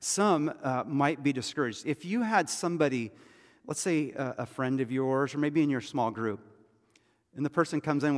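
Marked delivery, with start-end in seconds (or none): none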